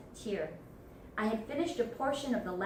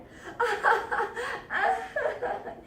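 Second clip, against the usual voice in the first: high, drawn-out cackling laugh; forced desperate laughter; 11 semitones higher than usual. Acted. Fortunato behind the wall, desperate laughter. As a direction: laugh on and on, nearly crying. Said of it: "a low laugh".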